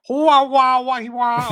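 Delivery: loud, high wailing wahs; mocking gibberish mimicry. Imitating friend jumping straight into an accent, hammed-up.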